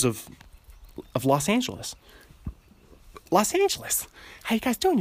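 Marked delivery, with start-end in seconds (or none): 3.3-4.1 put-on a much higher, sing-song Los Angeles accent on Los Angeles. Showing listeners his Los Angeles accent, high and bouncy. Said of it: "that's my Los Angeles accent". 4.4-5.0 put-on a much higher, sing-song Los Angeles accent on Los Angeles. Showing listeners his Los Angeles accent, high and bouncy. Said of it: "that's my Los Angeles accent".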